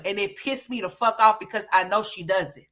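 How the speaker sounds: normal talking voice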